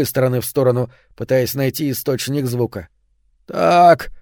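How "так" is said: higher, louder otherworldly grating voice; eldritch horror. A narrator's reading. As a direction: grate it like something not human. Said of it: "скрежещет он потусторонним голосом".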